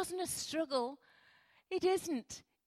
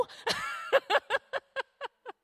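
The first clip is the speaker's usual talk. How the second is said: loud, high burst of laughter; big laugh; 13 semitones higher, 7 dB louder than usual. Real, not acted. Preacher laughing at her own child impression, loud and high.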